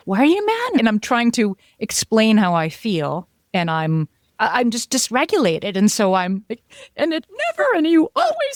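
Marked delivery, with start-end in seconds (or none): 0.1-0.8 much higher, squeaky mock husband; mocking spouse. Imitating her spouse asking why she is mad, mock squeak. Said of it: "He was like". 7.0-8.6 much higher, pinched half-sobbing rant; mock hysterical rant. Imitating herself ranting at her spouse, mock hysterical.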